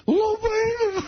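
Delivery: mocking squeaky voice, high and mumbled; imitating a full-mouthed, squeaky guy; High Pitch impression. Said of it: "talking to High Pitch".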